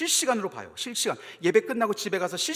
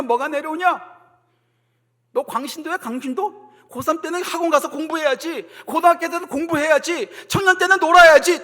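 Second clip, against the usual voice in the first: raised jeering voice building to a shout; scornful criticism; 8 semitones higher, 11 dB louder than usual. Acted. The world to churchgoers, scornful nagging that builds. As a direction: nag mockingly, louder with each demand. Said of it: "비난할 거예요".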